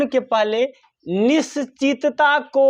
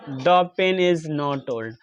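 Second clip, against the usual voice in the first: slow dictation; 6 semitones lower, 4 dB quieter, much slower than usual. Shown as a demonstration. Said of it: "teacher reads example slowly".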